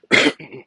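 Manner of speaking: a short, real cough. Real, not acted. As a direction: cough once mid-sentence. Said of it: "Sorry for the cough".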